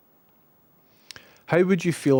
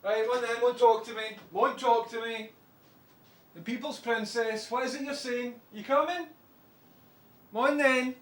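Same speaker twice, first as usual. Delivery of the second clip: soft pet talk; 9 semitones higher, 6 dB quieter than usual. Real, not acted. Coaxing the shy dog to come over, soft high pet voice.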